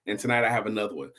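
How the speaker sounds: ordinary delivery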